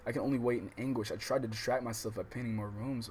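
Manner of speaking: steady read-aloud voice for the novel; calm visual novel reading; story narration. A narrator's reading.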